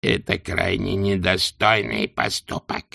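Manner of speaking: slow, soft crackling sword voice; crackling silk voice. A narrator's reading. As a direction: let it rustle like torn silk. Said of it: "голос, который походил на треск шёлка".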